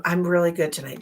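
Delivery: usual speaking voice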